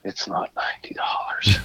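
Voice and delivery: whispered aside, barely voiced; a hushed retort nobody should hear; muttered under breath. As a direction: say it under your breath to yourself. Muttered reply to pushy man.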